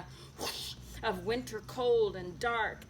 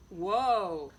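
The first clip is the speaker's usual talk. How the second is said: louder, lightly voiced horse call; playful whoa; 5 semitones lower, 5 dB louder than usual. A narrator's reading. Tacey leaving, saying whoa, playful horse call. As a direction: tease as a goodbye. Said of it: "and she goes".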